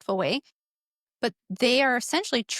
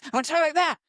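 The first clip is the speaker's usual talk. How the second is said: raised-pitch put-on voice; different role-play voice; 5 semitones higher, 3 dB louder, much faster than usual. Shown as a demonstration. Parent practicing different voices with a child, higher-pitched voice. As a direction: show another voice, pitched moderately high. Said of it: "let's speak in different voices".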